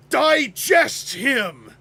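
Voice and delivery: booming toy commercial villain shout; commanding cartoon villain. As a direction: bark it like an eighties cartoon villain. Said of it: "in a commanding eighties c-, toy commercial cartoon voice".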